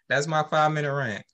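ordinary delivery

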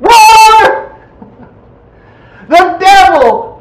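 loud, high one-word rebuttal; emphatic shout. Real, not acted. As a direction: shout it down like a preacher. Preacher shouts down the quote.

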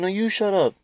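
high mock squeak; squeaky voice. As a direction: squeak it back. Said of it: "He's like".

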